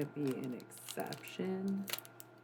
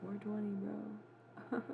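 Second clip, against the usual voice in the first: ordinary talk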